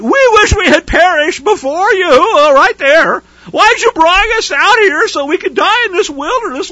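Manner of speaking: high, loud whining complaint; mock whiner. Teacher mocking Israelites complaining to Moses, high whining. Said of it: "They whine and they came against Moshe".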